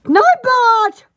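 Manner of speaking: ordinary talk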